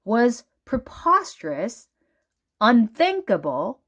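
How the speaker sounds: ordinary delivery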